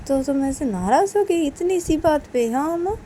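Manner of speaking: acted mother's mild scolding; mild remonstrance. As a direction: chide her with a lifted, questioning tune. Mother questions upset Uma.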